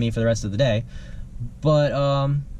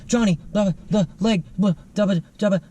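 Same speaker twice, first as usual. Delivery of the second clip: lightly voiced stammering caveman babble; a hint of a cave man stammering nonsense; caveman gibberish; 4 semitones higher than usual. Coworker stammering caveman babble at the one-legged narrator. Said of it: "starts stammering some Neanderthal language".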